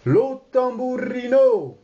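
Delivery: high, rolled-r Italianate announcement; announcing. Acted. Servant announces the famous singer, grand and high. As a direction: announce him grandly to the salon. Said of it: "Peterman annonçant".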